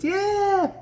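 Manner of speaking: high, drawn-out yeah; mock-casual high yeah; buddy's easygoing yeah. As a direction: imitate a confident buddy's high-pitched yeah. Acts buddy's easygoing invite.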